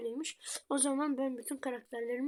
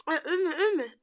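panicked; 4 semitones higher, 8 dB louder, slower than usual. Real, not acted. Panicking during game fight.

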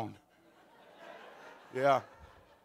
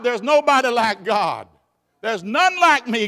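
loud, high, ringing preaching; preaching at full force; 5 semitones higher, 9 dB louder than usual. Acted. Preacher rousing the congregation, loud and high. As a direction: lift the whole church with it.

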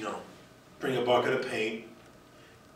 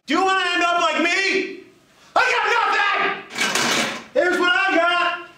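shouting; 4 dB louder than usual. Acted. Principal rants about his failures.

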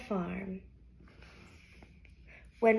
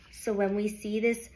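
usual speaking voice

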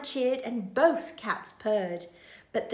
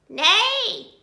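high, loud neigh; goose faking neigh; 11 semitones higher, 10 dB louder than usual. A narrator's reading. Goose tricking the thieves, neighing with all her might. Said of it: "the goose said".

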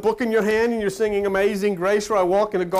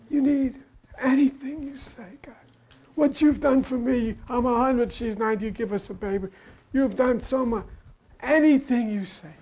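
fawning Abraham; 5 semitones higher than usual. Acted.

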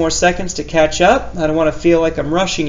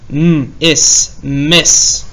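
normal talking voice

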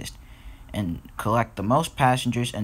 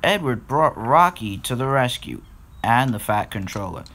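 usual speaking voice

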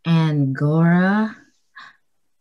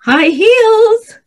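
high, loud cry of delight; excited squeal; 13 semitones higher, 7 dB louder than usual. Acted. Exclaimed answer in staged question list.

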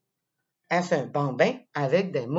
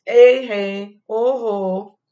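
much louder mock-scolding dad; 4 semitones higher, 10 dB louder, slower than usual. Dad catches the child with candy, loud mock scold. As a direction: boom out a playful warning. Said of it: "Il me dit".

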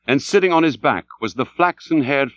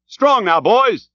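high, raised rallying call; urging rowers; 10 semitones higher, 3 dB louder, slower than usual. A narrator's reading. Starbuck spurs his boys on, calling out high. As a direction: break off to spur the oarsmen.

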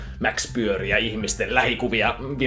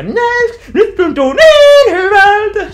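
very high, loud, slow blissful coo; cooing mascot; 16 dB louder, much slower than usual. Retold or imitated. Mascot hugging the teen, very high, loud and slow. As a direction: melt into the hug with a drawn-out squeal.

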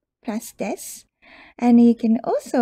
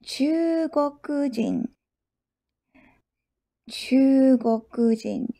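Chugokujin drawn out syllable by syllable; slow teaching pace; slower than usual. Shown as a demonstration. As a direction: stretch Chugokujin slowly for learners.

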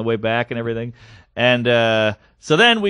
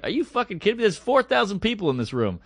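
higher-pitched, softer retelling of an irked comeback; comic in a mood; 5 semitones higher, 5 dB quieter than usual. Retold or imitated. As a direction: retort to a heckler in mock disbelief. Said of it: "She's in a mood".

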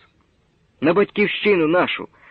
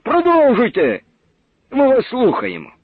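called out encouragement; 8 semitones higher than usual. Acted. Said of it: "вигукнув".

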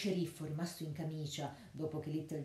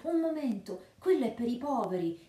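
high, loud cry of protest; shouting friar; 6 semitones higher, 6 dB louder than usual. A narrator's reading. Friar shouting at the sheriff to protect the poor box. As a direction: shout it out to stop him. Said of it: "gridò il frate".

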